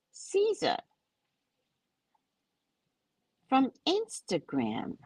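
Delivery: high, slow, sing-song send-up of his name; mocking Caesar. Mocking the texter's intro, high and drawn out. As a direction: parody his self-introduction slowly.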